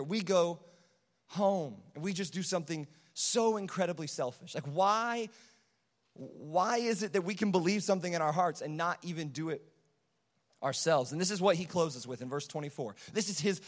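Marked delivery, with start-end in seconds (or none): none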